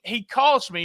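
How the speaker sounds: ordinary talk, nothing marked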